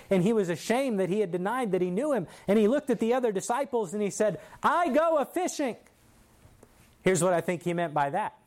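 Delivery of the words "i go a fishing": high-pitched emphatic announcement, retold; discouraged declaration. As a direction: throw it out high, as if giving up. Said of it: "Peter was so discouraged".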